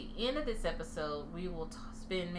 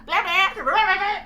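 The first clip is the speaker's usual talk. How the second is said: mock Jamaican gibberish; 11 semitones higher, 13 dB louder than usual. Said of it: "she was like".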